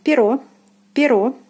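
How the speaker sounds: перо with full stressed final "o"; careful model перо, weight on о. Shown as a demonstration. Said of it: "Again, O is stressed".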